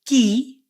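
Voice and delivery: "Key" with a clear long ee sound. Shown as a demonstration. Teacher modelling "Key" for learners, stretched ee. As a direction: say "Key" with a bright, drawn ee. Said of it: "you don't pronounce the vowel E the same way".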